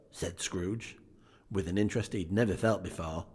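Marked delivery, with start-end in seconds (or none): none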